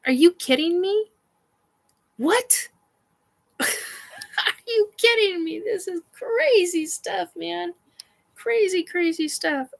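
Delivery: high excited mock freakout; excited disbelief. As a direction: relive the shock with a laugh. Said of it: "I was like".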